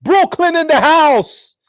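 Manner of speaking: high, loud, drawn-out whoop; mock hype cheer. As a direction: holler it like a hammed-up hometown shout. Mock hype about her origins.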